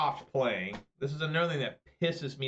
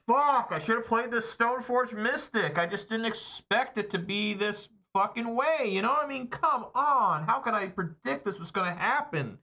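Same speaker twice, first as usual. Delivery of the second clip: frustrated yelling; 7 semitones higher than usual. Real, not acted. Card player shouting at his losing deck, furious. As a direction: rage at the bad luck, shouting.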